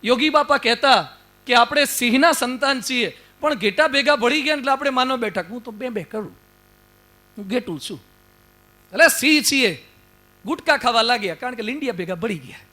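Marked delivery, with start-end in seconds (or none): 5.5-8.0 much softer, timid bleating voice; meek sheep voice. Acted. The preacher mocks people who think they are sheep, in a meek, much softer voice.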